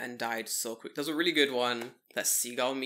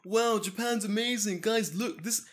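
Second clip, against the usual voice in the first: higher, awed TV-viewer gush; mock amazed viewer; 7 semitones higher than usual. Mocking Japanese viewers gushing over the show. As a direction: gush like an easily impressed fan.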